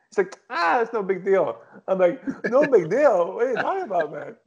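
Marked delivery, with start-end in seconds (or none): 0.5-1.6 higher-pitched scoff imitating brother; mock nonchalant brush-off; dismissive. Retelling brother brushing off fatherhood. 2.5-4.4 high-pitched disbelieving comeback; retold indignant disbelief; incredulous. Retold incredulous reply to brother.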